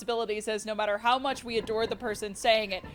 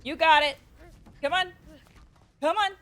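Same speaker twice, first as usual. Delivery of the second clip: higher and higher urgent come on; rising urging; 6 semitones higher than usual. Real, not acted. Streamer urging her creature across a jump, excited. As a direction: coax it on, getting more eager.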